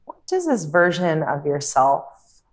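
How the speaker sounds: calm meditation guide, long pauses between phrases; guided meditation. Acted. Yoga teacher guiding listeners through a visualization, calm meditative delivery. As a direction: ease them into stillness, unhurried and measured.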